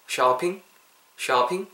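"쇼핑" as "sha-ping", open first vowel; "쇼핑" opened to an American-style "shah". Shown as a demonstration. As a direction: model "쇼핑" for repeating, with a clear "ah". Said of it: "따라해보세요".

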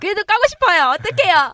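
loud, high-pitched helpless cry; playful exasperated outburst. Real, not acted. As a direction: burst out loudly, comically torn about it. Playful outburst admitting the urge to criticize.

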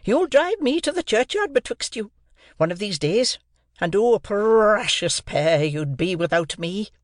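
put-on a harsh sister berating a boy; scolding sister. A narrator's reading. Mrs Joe scolds.

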